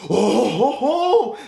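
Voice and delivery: goofy rising oh ho ho; a little sprout pleased with itself; hammed-up happy chuckle voice; cheerful seed voice. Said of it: "He goes".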